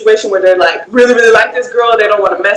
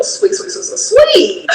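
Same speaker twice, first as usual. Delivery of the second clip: high, slow teasing sweet; laughing sing-song tease; 9 semitones higher, slower than usual. Pretend. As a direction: drag out each sweet in a mocking lilt.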